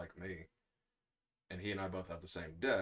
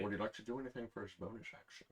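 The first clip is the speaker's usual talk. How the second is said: accidental slip into a Scottish accent; lightly Scottish, Connery-like lilt; Sean Connery; 2 semitones higher, 5 dB quieter, faster than usual. Real, not acted. Asking about a bonus action, accidental Connery voice. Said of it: "I turned into Sean Connery".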